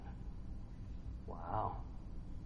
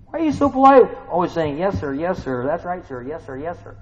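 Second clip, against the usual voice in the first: mock scolding; 6 semitones higher, 9 dB louder than usual. God voiced scolding polite yes-man.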